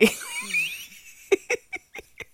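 very high, loud cackle; big cackling laugh. Real, not acted. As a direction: burst out in squealing laughter. Cracks up over John boy.

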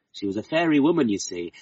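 ordinary delivery

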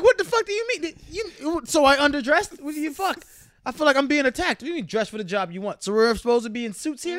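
high, loud indignant rant; mock outrage. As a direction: rant like you've been insulted. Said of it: "I'm like".

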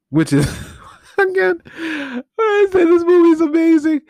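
high-pitched laugh through the words; cracking up mid-sentence. Real, not acted. Host laughing at how absurd the movie is, real laughter.

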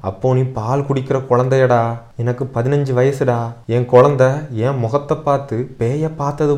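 high, impassioned maternal accusation; tearful reproach. A narrator's reading. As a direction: pour out old grief as pointed questions to your son. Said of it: "கண்களில் கண்ணீர் வழிய கேட்கும் போது".

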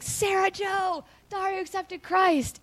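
high, slow, drawn-out calling of the news; joyful announcement. Retold or imitated. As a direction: call the happy news across the room. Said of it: "I was like".

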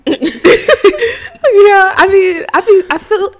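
high, loud, helpless laughing; weak with laughter. Real, not acted. Friend cracking up at the other's story, weak with laughter. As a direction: try to talk through real laughter. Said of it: "I'm weak".

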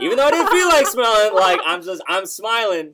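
high, loud words through laughter; laughing. Real, not acted. Guest laughing about smiling on camera after falling, laughing. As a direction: laugh while you tell it. Laughing at forced smile story.

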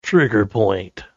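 slow, drawn-out announcer read; movie trailer voice. Shown as a demonstration. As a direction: drag it out like a trailer narrator. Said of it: "The, the trailer voice guy".

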